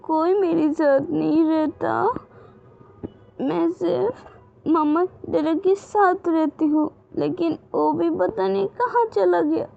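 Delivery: put-on high, small child's pleading voice; a lost little girl asking to be cared for; little girl's voice. A narrator's reading. Little Wing asks Gaga to stay, in a high childlike plea.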